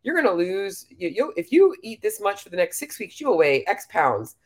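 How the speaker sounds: lightly voiced know-it-all app; a hint of a smug calculator app. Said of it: "MyFitnessPal is the worst".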